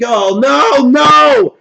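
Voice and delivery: high, loud wail of protest; rising, anguished retold shout; dismayed cry. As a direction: re-enact watching disaster happen. Retold dismay at blue screen.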